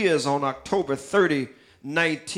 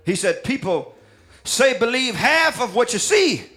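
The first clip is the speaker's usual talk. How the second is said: preaching the lyrics; 4 semitones higher than usual. Acted. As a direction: preach the song lines to the church with mounting fire. Preacher declaims song lyrics, rising.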